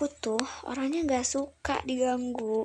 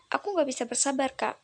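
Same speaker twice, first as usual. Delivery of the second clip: usual speaking voice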